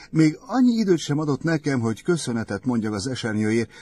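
quick, high, loud mock reproach; reproachful. A narrator's reading. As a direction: scold him playfully and fast. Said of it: "szólt Eve szemrehányó hangon". (gyors, magas, tréfás szemrehányás)

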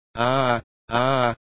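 understated acted wailing in agony; mild yell. A narrator's reading. Eric claws at his own skin, mild yell. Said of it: "ordítani és ugrándozni kezdett".

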